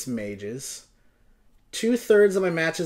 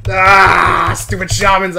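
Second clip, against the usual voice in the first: frustrated groan; 3 semitones higher, 12 dB louder, slower than usual. Real, not acted. Complaining about loss.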